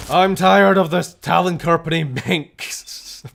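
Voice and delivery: slow, very loud high bellow; hammed-up combat yell; fed-up shout. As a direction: bellow at the enemy, drawing it out.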